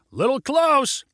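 high, projected call; shouted. A narrator's reading. Pilot shouts after near miss, high shout. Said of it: "shouted Junie".

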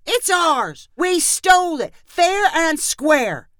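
put-on high-pitched, emphatic kid's protest; insistent child. A narrator's reading. Boy pirate defending loot to policeman, high insistence. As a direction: insist on it as a stubborn boy, drawing out the end. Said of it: "Amos insisted".

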